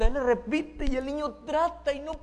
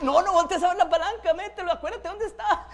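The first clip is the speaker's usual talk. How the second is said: laughing scold; 6 semitones higher, 6 dB louder than usual. Acted. Instructor scolding nervous learner.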